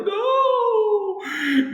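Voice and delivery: high, loud mock yelp of protest. Child protests dad's spanking, high mock yelp. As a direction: squeal it out high like a kid being spanked.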